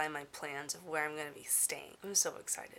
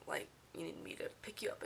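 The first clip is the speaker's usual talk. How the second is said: whisper; 10 dB quieter than usual. Retold or imitated. Aside while dropping friend off.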